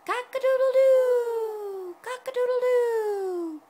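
loud, high, drawn-out crowing; long high cock-a-doodle-doo, falling at the end; rooster crow. Shown as a demonstration. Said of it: "What does the rooster say?".

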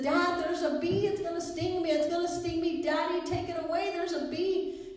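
high-pitched imitation of a frightened child; panicky little boy. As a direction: plead like a scared small boy, without full screaming. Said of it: "the little boy starts screaming".

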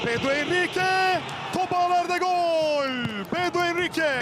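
high shouted goal call; screaming football commentator. Real, not acted. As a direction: scream the scorer's name. Equalising goal called live.

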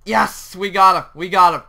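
loud victory cry; excited, punchy cheering; triumphant shout. Real, not acted. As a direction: celebrate loudly. Tiger is finally caged.

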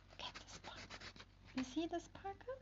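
whisper. Real, not acted. Reader whispers to listening children to share the wonder. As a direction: whisper to draw them in close.

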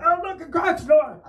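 high, mock-excited exclamation; excited shoppers. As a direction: imitate tourists squealing over a find. Voices crowd spotting a store.

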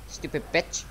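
normal talking voice

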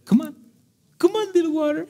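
high, coaxing voice to a little stream; coaxing Moses. Acted. Moses coaxing the rock to bring water, high and pleading. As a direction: sweet-talk the rock into giving water.

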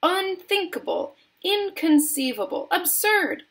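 high exclamations, word by word; theatrical high-pitched dismissal; emphatic cries. A narrator's reading. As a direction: pile up indignant denials. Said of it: "cried Mister Wonka".

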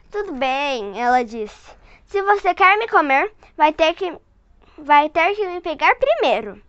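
put-on lively read-aloud voice for a child; storyteller voice for a little one; story narration. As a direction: read it like a bedtime picture book. Reading picture book to child.

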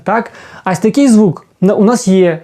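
ordinary talk, nothing marked

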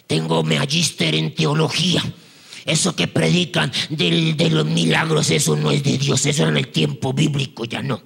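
low, pompous put-on scholar voice; deep voice, pronouncing judgement like a know-it-all; mock theologian. Self-important theologian dismissing miracles, low mocking voice.

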